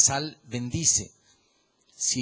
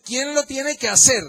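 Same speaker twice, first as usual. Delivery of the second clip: rising challenge; 10 semitones higher, 7 dB louder than usual. Real, not acted. Preacher challenges congregation loudly.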